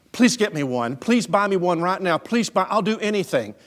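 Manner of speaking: high, loud, urgent pleading; imitating a boy's insistent begging; begging child. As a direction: plead like a kid desperate for a toy. Boy begs father for toy.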